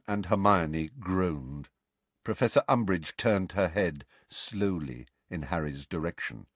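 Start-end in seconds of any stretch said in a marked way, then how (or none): none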